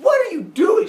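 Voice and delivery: high, loud shocked yelp; indignant shout. Retold or imitated. Slapped Zeus to Semele, indignant shout. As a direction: yell it like you've just been slapped.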